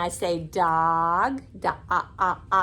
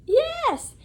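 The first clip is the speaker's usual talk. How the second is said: excited praise. Real, not acted. Praising a child's answer.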